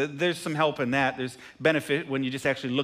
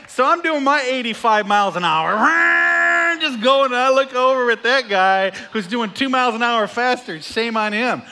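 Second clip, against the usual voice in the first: loud, high preacher's roar; loud, high, mocking sermon; preaching at full force; 6 semitones higher, 7 dB louder than usual. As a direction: drive the point home to the pews.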